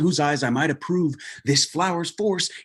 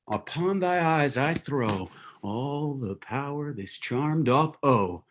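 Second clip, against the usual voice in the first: ordinary talk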